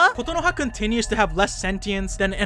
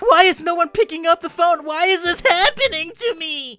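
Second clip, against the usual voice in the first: higher, self-pitying whine; boy whose calls go unanswered, higher self-pitying whine; whiny Makoto; 8 semitones higher, 4 dB louder than usual.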